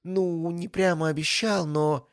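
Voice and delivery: put-on a blushing girl backpedalling; embarrassed fluster. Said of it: "Она покраснела".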